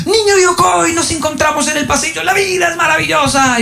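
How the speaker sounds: saludo eufórico y muy agudo del maestro; alegría gritona imitada. Retold or imitated. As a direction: grita agudo, como quien atrapa a un alumno en el pasillo. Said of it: "es una gritadera". (grito agudo de maestro exaltado)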